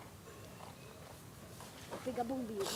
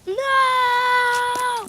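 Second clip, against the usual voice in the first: mock scream; 12 semitones higher, 15 dB louder than usual. Fake fright at game start.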